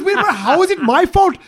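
put-on high, loud cashier protesting in an Indian accent; mock exasperated shout. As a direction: fire it back at him, high and indignant. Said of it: "And she goes".

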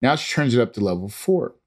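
ordinary delivery

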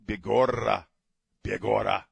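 hammed-up Mexican accent on Irish phrases. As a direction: roll the R's in a mock Mexican accent. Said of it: "with a Mexican accent".

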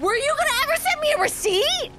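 high incredulous question. Acted. Teasing on the phone.

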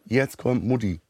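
ordinary talk

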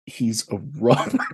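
laughing through the punchline; breaking into laughter. Real, not acted. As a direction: crack up halfway through the words. Cracks up repeating the punchline.

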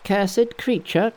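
high, slow threatening cry of Monkey; angry cry. Acted. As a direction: snarl it at the turtle. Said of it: "cried Monkey".

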